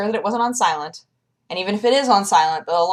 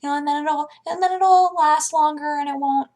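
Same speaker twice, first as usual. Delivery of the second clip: makeup lady voice; 7 semitones higher than usual. Retold or imitated. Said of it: "She was like".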